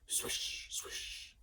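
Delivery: soft breathy swish sound; whispered swish. A narrator's reading. Narrator voicing the headmaster's cape twirling, whispered swish.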